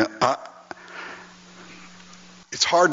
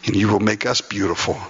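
lower, hushed prayer voice; 5 semitones lower than usual. Acted. Pastor leading the congregation in prayer, hushed and earnest.

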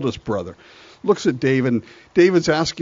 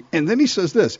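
ordinary talk, nothing marked

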